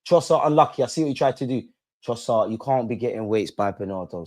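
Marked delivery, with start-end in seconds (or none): none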